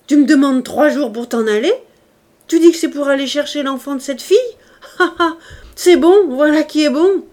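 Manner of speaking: loud, high jeering, building; mock his request at full voice; shouted scorn. A narrator's reading. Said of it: "cria Javert".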